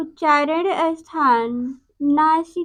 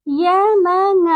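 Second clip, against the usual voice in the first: Yamang said slowly and loud, the nasal ङ held long; drawn-out Yamang; 3 semitones higher, 3 dB louder, much slower than usual. Shown as a demonstration. Teacher showing children the nasal ङ in Yamang, slow and loud. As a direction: stretch out Yamang, leaning on its nasal ङ. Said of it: "ये ङा है".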